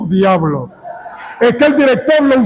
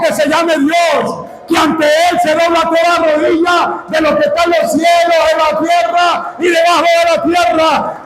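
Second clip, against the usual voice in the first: full-force preaching; 5 semitones higher than usual. Acted. Preacher rousing the congregation, full-force shout. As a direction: preach it out with fire to the crowd.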